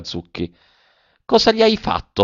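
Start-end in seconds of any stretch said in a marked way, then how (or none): none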